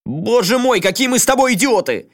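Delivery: high, loud cry of realisation; sudden shout. A narrator's reading. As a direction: shout it out in a flash of insight. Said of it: "вдруг закричал". (внезапный крик)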